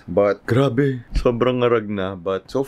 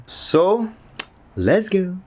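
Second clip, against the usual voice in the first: excited; 10 semitones higher than usual. Real, not acted. Cheerful vlog sign-off.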